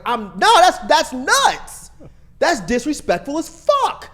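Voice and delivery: loud, high-pitched indignant protest; retold outrage. Boyfriend re-enacting his argument with his girlfriend, loudly outraged. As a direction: protest hotly, dragging out the insult. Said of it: "I was so fucking mad".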